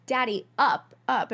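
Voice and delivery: put-on high, loud little-kid demand; child's scream. Little girl begging her dad to lift her, high and loud. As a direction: yell it like a toddler wanting up. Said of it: "I used to scream at him".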